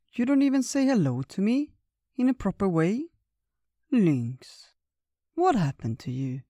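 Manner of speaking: an old friend's hurt reproach, voiced high; old bear voice. Old Bear scolding Lynx.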